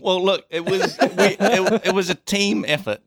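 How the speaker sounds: sheepish laughing defense; laughing excuse. Real, not acted. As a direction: wriggle out of blame, laughing. Defending self while laughing.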